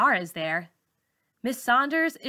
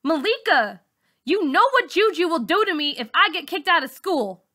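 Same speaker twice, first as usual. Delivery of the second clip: louder, much higher urgent pressure; pleading threat; 8 semitones higher, 5 dB louder than usual. Acted. Bully pressing a frightened girl not to tell, loud and high. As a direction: lean on her hard.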